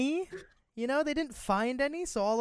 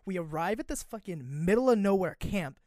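ordinary talk, nothing marked

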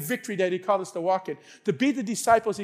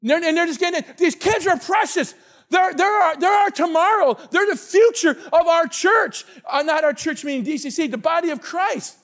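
raised, insistent sermon delivery; fired-up preaching; 6 semitones higher, 5 dB louder than usual. Real, not acted. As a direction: preach it loud and high to the congregation. Preaches at full force.

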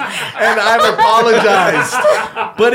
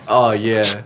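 deep, slow, mock moans of pleasure; low sexy moaning; 10 semitones lower, much slower than usual. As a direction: ham up the bedroom moans.

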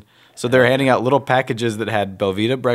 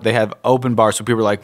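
normal talking voice

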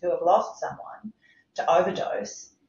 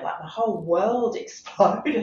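slow, higher words broken by a laugh; 4 semitones higher, 3 dB louder than usual. Real, not acted. Speaker laughing at his past naivety.

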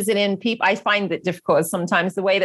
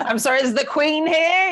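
mocking father; 7 semitones higher than usual. Father teasing his daughter: high mock-posh joke. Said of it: "my father says".

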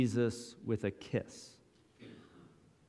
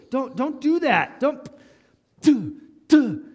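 higher, louder scold with spit sounds; spitting back; 10 semitones higher, 7 dB louder than usual. Pretend. Mocked and spat upon, fights back.

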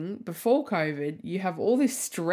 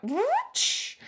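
whoosh sound. Retold or imitated. Fast-forwarding the day.

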